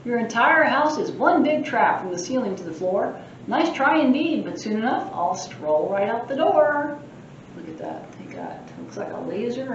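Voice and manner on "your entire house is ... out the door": a lightly voiced mischievous leprechaun boasting; cheeky leprechaun. Librarian reading the leprechaun's boasts aloud, cheeky. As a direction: tease the reader like a sprite.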